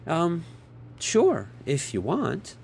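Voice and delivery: slow, hesitant and unsure; stall, a little confused; halting, puzzled agreement. A narrator's reading. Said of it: "A little confused".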